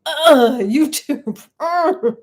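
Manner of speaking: loud, high exasperated groans; acted frustration. Teacher replaying her annoyance at YouTube, frustrated and exasperated. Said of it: "I'm frustrated, annoyed, and like".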